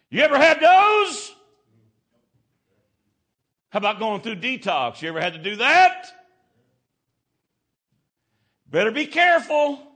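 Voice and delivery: high, loud, shouted preaching; full-force preaching. Acted. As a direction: preach it out at the top of your lungs.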